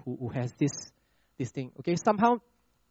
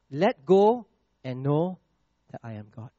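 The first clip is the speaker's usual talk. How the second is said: slow and drawn out; 4 dB louder than usual. Shown as a demonstration. Preacher drawing out verse.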